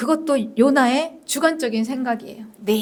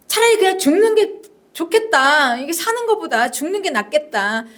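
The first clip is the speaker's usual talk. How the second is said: high-pitched plea, falling away at the end; desperate prayer; 5 semitones higher than usual. Acted. Jonah begging God to end his life, desperate prayer. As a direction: beg to be let die. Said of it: "이게 이제 요나의 기도였습니다".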